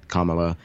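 "Kamala" with first-syllable stress, KAH-muh-luh; KAH-ma-la pronunciation. Shown as a demonstration. Co-host recalling a woman's name, "Kamala" as KAH-muh-luh. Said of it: "she pronounces it".

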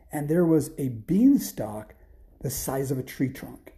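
usual speaking voice

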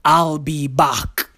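deadpan it like the Terminator; Terminator impression. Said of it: "these bots go".

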